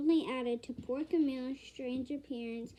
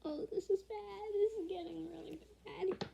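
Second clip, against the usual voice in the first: hushed, higher worried aside; worried gasp; 6 semitones higher, 4 dB quieter than usual. Real, not acted. Reader fretting over the story, softly worried. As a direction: fret quietly that things look bad.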